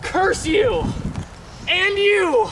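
wounded friend cursing both families; dramatic curse. Acted. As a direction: spit the curse out as you fall. Dying Mercutio curses both houses.

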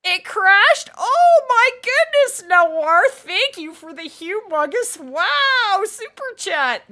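loud, high, astonished thanks; big drawn-out wow of surprise; amazed gratitude. Real, not acted. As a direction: gush in real amazement at a huge gift. Reacting to a huge super chat.

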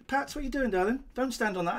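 high-pitched aside to a darling; talking to Pats. Real, not acted. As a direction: break off to tell off Pats sweetly. Breaks off to speak to Pats.

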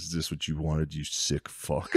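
imitating drugged low mutter. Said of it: "He goes".

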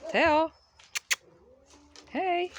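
high bright call to the dog; excited call. Real, not acted. Calling out to a dog.

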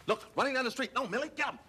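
high, frantic calling out; urgent alarm. Acted. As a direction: urge a rookie into action. Urgent alarm, crooks running by.

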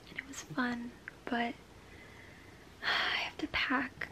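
soft, half-whispered confiding; hushed talk. Real, not acted. Vlogger packing at five A M, hushed talk. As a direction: keep it down, almost a whisper. Hushed vlog late at night.

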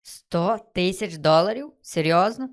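slow, drawn-out astonished question; amazed disbelief. Real, not acted. Player seeing how much money he got, amazed. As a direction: stretch it out, rising in disbelief.

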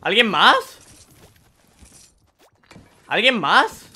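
high, loud hyped shouts; excited shouting. Real, not acted. Streamer hyping viewers over ten kills, excited shouting. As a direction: celebrate the record to the audience. Hitting a kill record.

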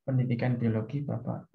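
low monotone. Shown as a demonstration. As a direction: drop the pitch and drag each word. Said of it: "Jangan misalnya". (suara rendah, pelan dan datar)

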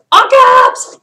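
high, loud mock shout; mock yelling. Shown as a demonstration. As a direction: bellow it like a caps-lock text. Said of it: "You're yelling".

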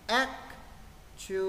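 actually broken into ac, tu, a, lly with pauses; actually drawn out, stress on the first syllable; slow, syllable by syllable. Shown as a demonstration. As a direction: sound out each part of actually slowly. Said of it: "Với trọng âm rơi vào âm X".

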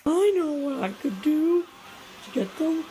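usual speaking voice

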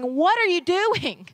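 Jesus chiding a distracted generation, exasperated. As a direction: ask it like a disappointed teacher. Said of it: "Jesus is saying".

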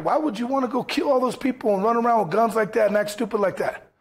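a lightly voiced son asking his dad why; voicing his son. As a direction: ask it like a puzzled young son. Son questioning father, voiced.

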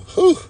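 high loud relieved whew; big whew. Real, not acted. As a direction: blow out a loud sigh of relief. Relief after being sick.

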